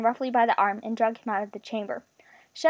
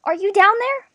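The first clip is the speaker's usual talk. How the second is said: high, loud calling-out; panic-stricken; 10 semitones higher, 9 dB louder than usual. A narrator's reading. Searcher calling to missing friends, panic-stricken. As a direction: call down in alarm to someone far away. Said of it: "a panic-stricken voice echoed from far away".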